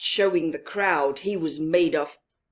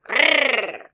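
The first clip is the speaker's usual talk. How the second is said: tiny growl; 5 semitones higher, 9 dB louder than usual. Acted. Mouse tries to roar.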